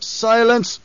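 a furious high roar. A narrator's reading. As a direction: cut him off with a bellow. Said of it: "the Emperor roared".

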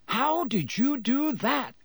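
high, slow squeal of amazement. A narrator's reading. Angelina to George throwing sweets, squealing. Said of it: "squealed Angelina Johnson".

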